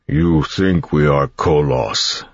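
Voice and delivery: slow, strained monster voice; words forced through stretched lips; Koloss voice. A narrator's reading. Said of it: "through lips that were stretched too tightly to work properly".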